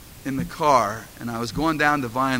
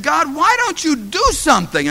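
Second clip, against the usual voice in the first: pleading cry pitched up high; desperate cry to God; 12 semitones higher, 5 dB louder than usual. Retold or imitated. Pastor retelling his prayer, high desperate plea. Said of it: "crying out to God".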